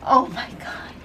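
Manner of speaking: breathy whispered oh my God; whispered cringe. Real, not acted. Host cringing at Caitlyn's day-one question, whispered. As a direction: whisper it, wincing.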